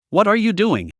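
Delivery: understated angry question, pitch up; mild angry snap. A narrator's reading. Rider scolding his dragon, mildly angry. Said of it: "Dongfang He said angrily".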